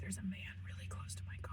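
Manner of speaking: hushed, quick unvoiced whisper. Real, not acted. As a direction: whisper fast so he can't hear. Startled by a man near car.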